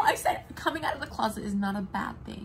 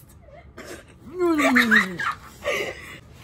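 cartoon laugh; 6 semitones higher, 11 dB louder than usual. Real, not acted. Wild laugh at the end.

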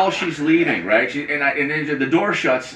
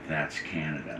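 quiet pointed remark; 11 semitones lower, 17 dB quieter than usual. Retold or imitated. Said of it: "she knew what she was saying when she said".

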